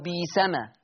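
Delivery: "بيسمة" with long ee and no glottal catch; smooth, demonstrated "بيسمة"; hamza becomes yaa. Shown as a demonstration. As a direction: hold the first vowel of "بيسمة" as ee. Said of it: "تبدل ياء".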